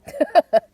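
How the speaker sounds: short loud real laugh; burst of laughter. Real, not acted. Laughing at the camera glitching, loud burst of laughter.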